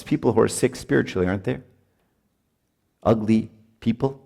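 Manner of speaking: ordinary talk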